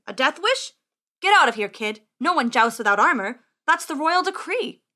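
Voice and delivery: loud, dismissive official; scornful scribe. Acted. Scribe ordering unarmored kid out of tent, scornful. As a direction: send him packing loudly.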